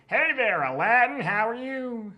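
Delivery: high, loud grating camel impression. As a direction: squawk it out like a cartoon camel. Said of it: "very, very grating voice".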